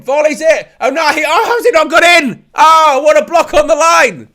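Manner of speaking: loud, high-pitched shouts of disbelief; excited commentary. Real, not acted. Commentator calling a block on the line, real excited shouting. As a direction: call the action at full pitch, astonished.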